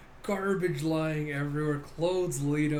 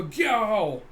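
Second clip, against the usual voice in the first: loud, higher monster cry; imitated kaiju roar; 6 semitones higher, 7 dB louder than usual. Giant kaiju rising from the ocean, imitated roar. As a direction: let out a mock beast roar.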